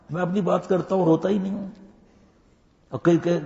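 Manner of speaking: usual speaking voice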